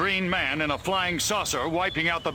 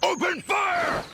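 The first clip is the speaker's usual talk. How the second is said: high, shouted order to fire; shouted command. Acted. Army officer shouts to open fire. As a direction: yell the command.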